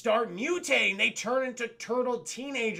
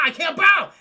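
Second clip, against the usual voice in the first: high, much louder desperate yell; panicked shout; 8 semitones higher, 13 dB louder than usual. Retold or imitated. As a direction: cry out that you can't bow. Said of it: "He's like".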